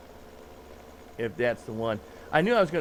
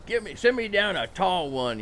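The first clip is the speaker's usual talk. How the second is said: swaggering order, a bit higher; cocky big shot; 5 semitones higher than usual. Acted. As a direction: order like a tough guy.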